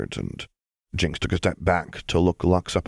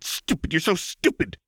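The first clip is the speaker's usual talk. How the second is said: frantic self-loathing; 11 semitones higher than usual. Acted. Punching herself in rage.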